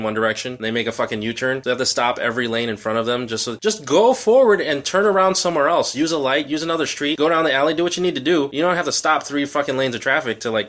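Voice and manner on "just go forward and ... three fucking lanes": exasperated rant. Real, not acted. Ranting about U-turning drivers.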